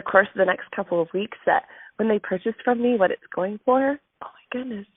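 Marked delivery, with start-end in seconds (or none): 4.2-4.9 soft, near-whispered marvel; hushed amazement. Real, not acted. Speaker marvelling at what her earnings fund, hushed amazement. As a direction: say it under your breath in wonder. Marvels at money funding party.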